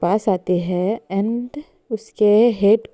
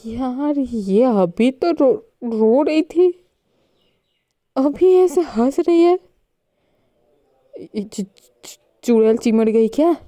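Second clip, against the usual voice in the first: shocked; 4 semitones higher than usual. A narrator's reading. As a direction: react stunned she's laughing now. Narrator's shocked aside. (हैरान होकर बोलना)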